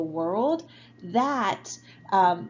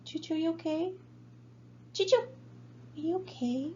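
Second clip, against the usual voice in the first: dog mom voice; 10 semitones higher, 6 dB quieter than usual. Real, not acted. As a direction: coo at the dog like a pet parent. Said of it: "You guys heard my dog mom voice right now".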